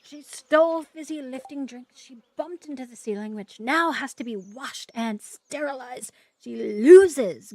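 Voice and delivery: loud, slow, stern candy-maker pronouncement; Willy Wonka scolding. Acted. Streamer quoting Wonka to the game, stern loud verdict. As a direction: deliver the verdict like an outraged chocolatier.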